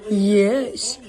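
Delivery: goofy puppy-talk voice. Real, not acted. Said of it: "Why do you talk like that?".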